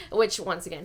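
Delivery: usual speaking voice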